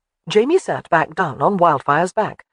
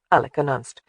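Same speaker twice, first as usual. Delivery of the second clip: ordinary delivery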